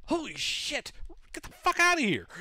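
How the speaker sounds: high-pitched, loud kobold shout; acted alarm, voice jumping way up; panicked call out. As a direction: cry out to the others to flee, high and loud. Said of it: "You hear them call out".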